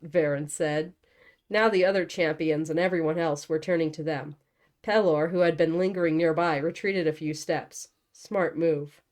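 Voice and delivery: ordinary delivery, nothing marked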